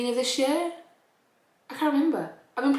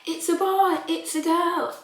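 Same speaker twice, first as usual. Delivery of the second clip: excited announcement; 8 semitones higher, 3 dB louder, slower than usual. Pretend. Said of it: "and they're like".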